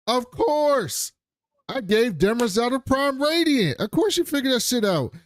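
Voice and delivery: a high, sarcastic told-you-so. Retold or imitated. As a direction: sing it out high like it's obvious. Sarcastic Seldon retort.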